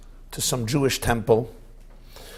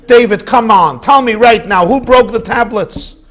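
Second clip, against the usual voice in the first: much louder and higher, mock fury; angry grandpa demanding; 7 semitones higher, 15 dB louder than usual. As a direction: bark the demand at the boy. Grandfather demands an answer, joke.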